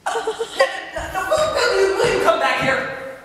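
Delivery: high, sputtering loud plea; sputter, then call them back urgently; frantic calling back. Acted. Husband calling his wife back, frantic.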